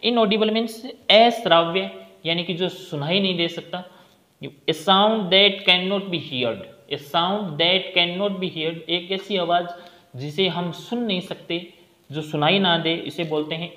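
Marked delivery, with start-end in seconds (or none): 4.7-8.8 very slow, word by word; slow dictation. Shown as a demonstration. Teacher dictating a definition to the class, very slow. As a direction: dictate it so students can write. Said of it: "teacher dictating definition".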